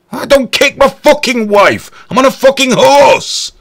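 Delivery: loud comic protest from the saddle; indignant shout. Real, not acted. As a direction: bellow it in disbelief. Indignant rant about horse ride.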